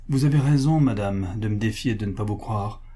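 ordinary delivery

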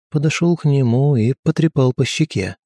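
plain reading voice

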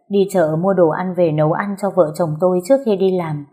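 ordinary delivery, nothing marked

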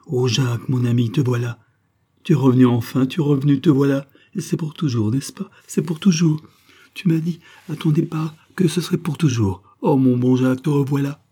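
put-on high, excited young woman; rapturous welcome. A narrator's reading. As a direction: pour out joy at a return.

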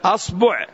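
أصبع said "as-bu'", a then u; short u after the ba in أصبع; damma on ba. Shown as a demonstration. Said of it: "نضم الباء مع الهمزة فنقول".

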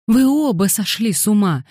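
high, aghast exclamation; gasped exclamation. A narrator's reading. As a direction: exclaim aghast, pitch jumping up. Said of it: "ахнула она". (ахнув, высоким голосом)

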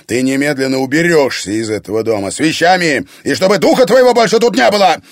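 loud order building to high shout; erupt, racing through the last words; explosive anger. A narrator's reading. Egor throwing daughter out of the house, explosive anger. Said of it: "взорвался Егор".

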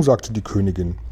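ordinary delivery, nothing marked

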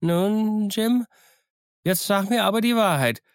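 put-on high, tense old hermit demanding truth; suspicious castaway gripping Jim's hand; stern castaway voice; threatening castaway. Castaway suddenly threatens Jim.

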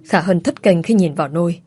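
ordinary delivery

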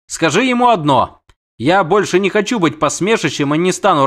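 high-voiced indignant siren; haughty siren. Siren dictates her terms to the witcher, indignant.

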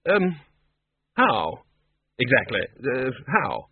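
halting, broken-up question; uncertain. A narrator's reading. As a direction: feel for the question between hesitations. Ridcully baffled by the news.

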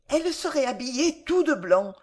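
put-on high-pitched, wistful child; dreaming little boy. A narrator's reading. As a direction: daydream aloud as a small boy. Child daydreams of mother. (voix aiguë d'enfant rêveur)

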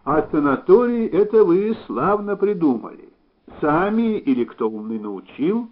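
lively shouted question, mildly acted; calling from afar. A narrator's reading. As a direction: call back to someone walking away. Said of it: "крикнул издали".

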